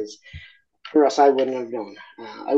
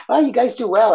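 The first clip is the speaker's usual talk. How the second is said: excited; 12 semitones higher, 7 dB louder than usual. Real, not acted. Laughing reply to host.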